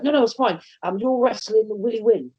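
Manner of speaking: boss voice. Retold or imitated. Boss casually telling him who he's wrestling, breezy and high. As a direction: play the boss casually dropping news. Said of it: "he's like".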